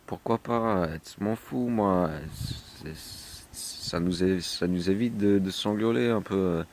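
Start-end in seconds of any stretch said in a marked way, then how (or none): none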